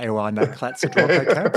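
"Ewa Nat Clat Sadroke cab" spoken as reversed syllables, quick; backwards speech. Shown as a demonstration. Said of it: "I can speak backwards as quickly as I can speak forwards".

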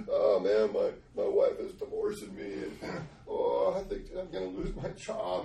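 groaning lament rising in pitch; mock distraught confession. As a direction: wail out your troubles, hammed-up. Acting out spilling his troubles.

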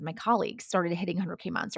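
normal talking voice

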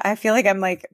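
normal talking voice

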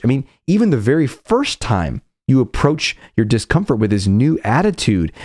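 ordinary delivery

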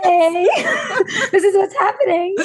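high, laughing mock reveal; joking about greeting the husband with a baby, high and laughing; laughing mock announcement. Mock surprise to waking husband.

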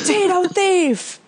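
high, slow mock yell of an accuser; mock shout. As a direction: call out the thief like an outraged crowd. Imagined crowd shouting at thief.

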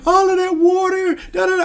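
high, loud mock-tearful mother; a hammed-up worried mom wailing about the water; crying mom imitated. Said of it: "She starts crying".

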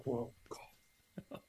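breathy whisper of shock; whispered dismay. Retold or imitated. Client realizing two thousand isn't enough, whispering in dismay. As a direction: let the air out in disbelief.

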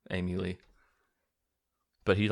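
ordinary delivery

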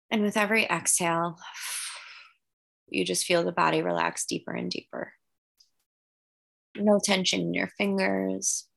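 calm guided breathing voice. Acted. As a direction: gently lead the breathing. Leads guided meditation visualization.

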